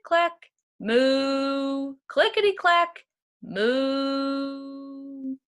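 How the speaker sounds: usual speaking voice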